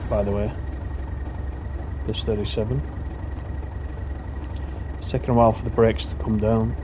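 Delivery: normal talking voice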